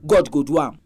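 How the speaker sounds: usual speaking voice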